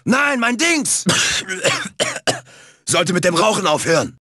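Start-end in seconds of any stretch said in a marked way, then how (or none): 1.1-2.4 hacking cartoon cough; acted fit of coughing. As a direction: cough repeatedly before speaking. Coughing fit before smoking remark.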